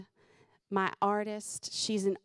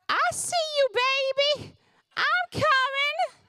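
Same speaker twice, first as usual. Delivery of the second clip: put-on very high, loud, slow old-lady voice; hundred-year-old lady; 6 dB louder, slower than usual. Daughter as old lady calling to mom in car line, shrieky old-lady voice. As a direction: call out as a hammed-up ancient granny. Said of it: "this hundred-year-old lady".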